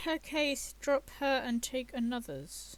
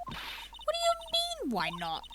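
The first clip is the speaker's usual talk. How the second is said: squeaky character voice; slower than usual. Acted. Player voicing shocked game character.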